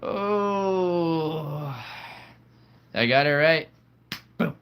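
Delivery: surprised cry at guessing the coin right; delighted surprise. Real, not acted. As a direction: cheer at winning the toss. Coin toss came up right.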